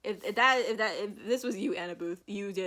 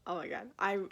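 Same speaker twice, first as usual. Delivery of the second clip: ordinary delivery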